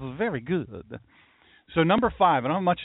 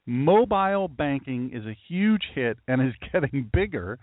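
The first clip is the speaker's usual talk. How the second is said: ordinary delivery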